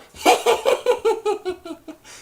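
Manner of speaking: high, hammed-up Grinch chuckle; high mock Grinch laugh. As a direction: cackle like the Grinch.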